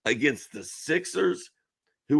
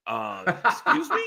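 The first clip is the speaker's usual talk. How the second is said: higher-pitched puzzled double take; mock taken aback; 7 semitones higher than usual. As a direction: act out a startled bystander. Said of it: "you'd be like".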